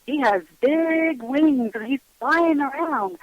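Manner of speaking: put-on high, drawn-out little-kid description; imitating small child. Child describing flying friend to mom, childlike wonder. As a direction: stretch the words like a three-year-old, singsong. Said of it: "And she said".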